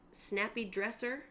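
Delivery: ordinary talk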